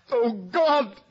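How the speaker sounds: high, loud cry of grief; anguished cry. Acted. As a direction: cry out to heaven at the sight. Laertes sees mad sister.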